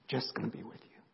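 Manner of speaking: emotional whispered repeat; whispered echo. Real, not acted.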